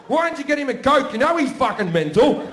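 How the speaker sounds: high, loud scolding; exasperated mum. Retold or imitated. Mum turns on the younger son over the Coke, furious scolding. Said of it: "my mum turns to me and goes".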